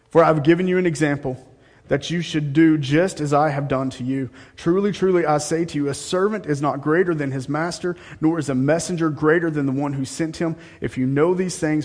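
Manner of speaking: loud, fast scripture reading; preaching at full force. Acted. Preacher booming out the scripture, loud and fast. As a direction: proclaim Jesus' words to the church.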